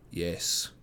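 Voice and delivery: low, husky single word forced out; husky, unnatural tone. A narrator's reading. Frightened mother forced to answer, husky and unnatural. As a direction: choke out the word in a strained husk. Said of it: "in a husky, unnatural tone".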